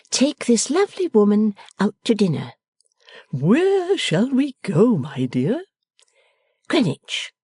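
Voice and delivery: a lively daughter giving orders fondly; bright young daughter. A narrator's reading. Bella playful.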